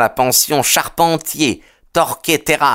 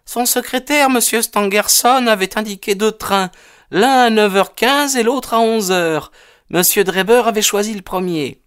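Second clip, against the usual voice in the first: higher-pitched woman's voice; anxious mother; 10 semitones higher than usual. A narrator's reading. Landlady giving the detective the train times, anxious and high. Narrator plays the questioned mother.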